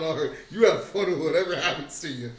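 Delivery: loud, gleeful threat, laughing; loud mock threat. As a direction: threaten him with a grin. Loud menacing tease.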